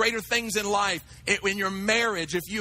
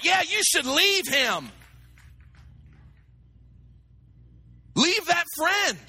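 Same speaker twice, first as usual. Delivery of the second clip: loud, high preaching climax; full-force preaching; 7 semitones higher, 3 dB louder than usual. Acted. Preacher urging the congregation to avoid naysayers, at full force.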